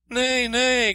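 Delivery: high-pitched loud call. A narrator's reading. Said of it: "called out the princess".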